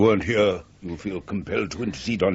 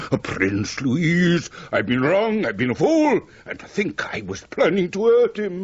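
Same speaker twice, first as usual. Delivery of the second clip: high, loud cry of remorse; remorseful outburst; 11 semitones higher, 4 dB louder than usual. Acted. Man crying out to Louise in remorse. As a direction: burst out in sudden regret. Man realizes he was wrong.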